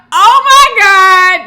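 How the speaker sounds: very high, loud outcry; loud mock-scandalized yelp; shocked exclamation. Real, not acted. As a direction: cry out as if personally called out. Reacts to table manners comment.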